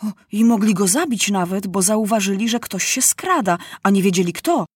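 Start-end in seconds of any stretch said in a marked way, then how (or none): none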